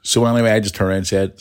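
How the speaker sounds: normal talking voice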